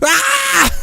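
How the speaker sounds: very high, loud yelp; a piercing high pug scream. Retold or imitated. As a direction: imitate the pug shrieking. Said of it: "it sounds like a person screaming".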